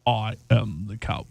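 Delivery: low, slow villain attempt; Palpatine. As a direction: try an evil emperor declaration, drawn out. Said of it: "That is very Palpatine-esque".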